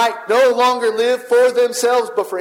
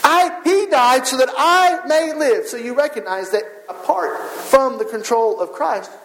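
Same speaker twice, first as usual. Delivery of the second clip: rising preaching; 4 semitones higher than usual. Acted. Pastor preaching to the congregation, rising and fervent. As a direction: preach it out, climbing in pitch.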